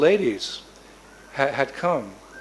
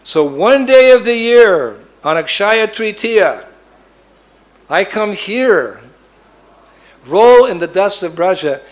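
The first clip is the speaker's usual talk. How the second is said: slow and much louder, booming majesty; grand kingly voice; 4 semitones higher, 8 dB louder, slower than usual. Acted. Prayag telling Nanda his yearly visit, booming regal voice. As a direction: proclaim it like a royal decree.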